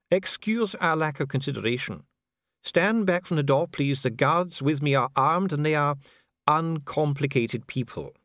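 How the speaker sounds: a bureaucrat soothing a prisoner; calm official Dee. A narrator's reading. Dee answering imprisoned Vimes, coolly polite.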